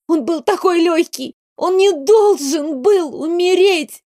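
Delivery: put-on girl crying over the dead rabbit; sobbing. A narrator's reading. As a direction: let the voice crack high in grief. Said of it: "Девочка всхлипнула".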